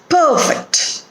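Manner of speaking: perfect as PER-fect, high then falling; stress on first syllable. Shown as a demonstration. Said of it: "First syllable is stressed".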